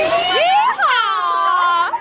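high, whooping holler; cowboy whoop. Pretend. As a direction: let out a playful yeehaw. Cowboy whoop before mountain coaster.

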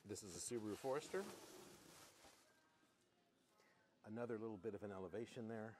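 golf announcer voice. Pretend. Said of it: "using our golf announcer voice".

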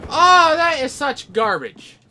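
loud, high, slow outburst; frustrated yell. Real, not acted. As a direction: rage at a cheap death.

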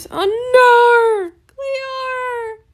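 high, loud, drawn-out mock cry; mock wail. Gamer reacts to a rejected trade, hammed-up wail. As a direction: wail it like a playful tragedy. Trade offer gets turned down.

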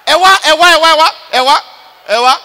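loud, high, repeated summons; urgent calling over. Acted. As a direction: call them over urgently.

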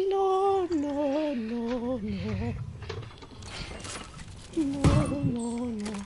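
maid on American Dad. Retold or imitated. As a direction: squeal no, no, fading out like the cartoon maid. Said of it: "I can sound like the maid on American Dad".

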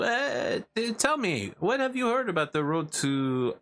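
hammed-up fake French; bad French accent. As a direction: ask in a put-on French accent. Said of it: "I'm intentionally doing a bad French accent".

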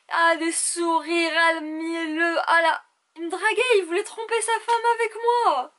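high, slow, outraged mockery; indignant retelling. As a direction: drag it out, scandalized. Mocking his flirting, worked up.

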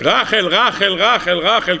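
normal talking voice

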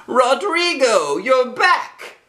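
put-on high, slow delighted old lady voice; old lady calling out to her lost moose; old lady greeting. Old lady to her moose, a high delighted greeting. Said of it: "Someone else thought she owned the moose".

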